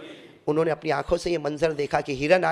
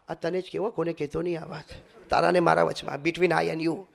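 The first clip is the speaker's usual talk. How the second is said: confiding gossiper; 4 semitones lower, 7 dB quieter than usual. Retold or imitated. Gossiper whispering secret.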